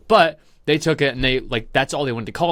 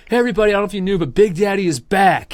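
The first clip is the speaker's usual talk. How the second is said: mic announcement; 8 semitones higher, 6 dB louder, faster than usual. Retold or imitated. Crew member on the mic hyping up the dining hall, loud announcer. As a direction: announce it to the whole room. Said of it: "one dude stands up on the mic".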